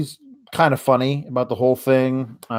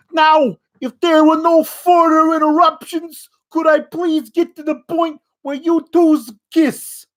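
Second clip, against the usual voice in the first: much higher, louder, hammed-up New York officiant; over-the-top New York accent; 13 semitones higher, 4 dB louder than usual. Last week's officiant to couple, over-the-top New York accent. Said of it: "that was what he sounded like last week".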